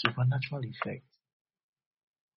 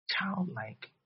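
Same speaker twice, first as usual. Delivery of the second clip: ordinary delivery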